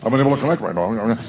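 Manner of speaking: fast, even machine voice; mock robot voice; Alexa's robotic voice. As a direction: rattle it off like a speaker. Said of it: "she starts this mantra".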